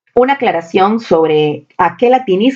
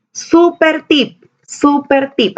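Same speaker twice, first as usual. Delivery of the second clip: high, slow, drawn-out super tip; excited announcement; 8 semitones higher, much slower than usual. Acted. Teacher hypes a bonus tip to students, high and slow. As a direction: sing it out like a big reveal.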